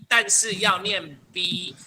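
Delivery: slowly, word by word, P then B; slow emphatic instruction. Shown as a demonstration. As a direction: spell it out slowly for the class. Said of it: "但是要念B哦". (放慢语速强调)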